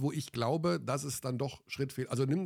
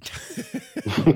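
breathy burst of helpless laughter; hysterical laugh; 8 dB louder than usual. Real, not acted. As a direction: laugh out loud, can't stop. Teased for laughing.

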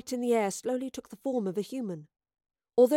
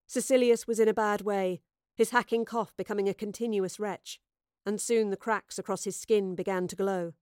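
usual speaking voice

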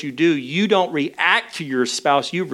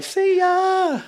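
much higher sing-song "See ya"; cheery goodbye. Retold or imitated. Parents leaving built-in babysitters in charge, high sing-song goodbye. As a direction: toss off a bright high farewell.

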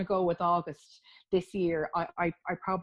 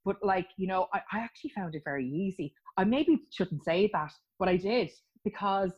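ordinary delivery

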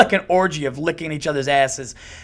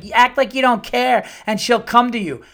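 high, louder mock-wise dating advice; mock teen dating advice; 9 semitones higher, 7 dB louder than usual. Dad mocking high school dating rules, high and loud. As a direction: play it like smug high school love advice. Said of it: "a high school relationship where it's like".

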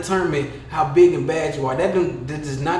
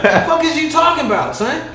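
loud, much higher, laughing disbelief; higher, louder mocking rant at a poser; incredulous shout; 9 semitones higher, 4 dB louder than usual. Real, not acted. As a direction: call him out loudly, half laughing. Mocking a fake New Yorker.

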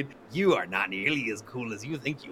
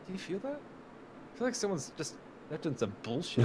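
much softer, higher musing voice; soft mock musing of a wary politician; mock Pence musing; 5 semitones higher, 10 dB quieter than usual. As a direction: murmur it like a suspicious old man. Voicing Pence sensing nonsense.

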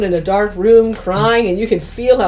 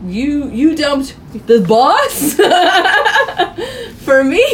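slow, stammered disbelief rising into laughter; retold disbelief; 5 semitones higher, 3 dB louder, slower than usual. Jane learns who was dumped, laughing disbelief. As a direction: laugh through mock astonishment.

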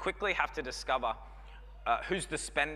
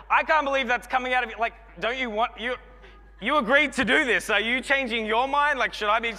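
higher, louder, rushed disbelief; shocked protest; 8 semitones higher, 6 dB louder than usual. Retold or imitated. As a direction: blurt out the shock of the groom. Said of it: "Just like".

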